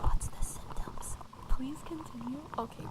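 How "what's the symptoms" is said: slow caring nurse whisper; hushed asmr bedside question; whispered nurse role play. Acted. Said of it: "the pet dog A S M R roleplay".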